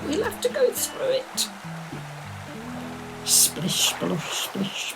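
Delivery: usual speaking voice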